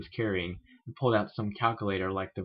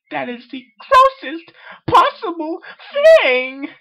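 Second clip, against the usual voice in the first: very high, loud theatrical wail; dramatic Rarity; 15 dB louder, slower than usual. Acted. Rarity reprising her meme to friends, very high and loud. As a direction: play it as a grand drama queen. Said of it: "reprise her award-winning meme".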